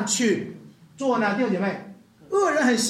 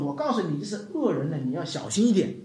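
usual speaking voice

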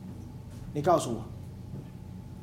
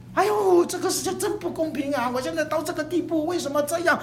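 a higher, wailing protest at an unfair world; mock ranting complaint; 8 semitones higher than usual. Preacher plays a believer railing at unfairness, higher mock wail. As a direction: cry out against the world, half-acted. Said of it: "就咆哮".

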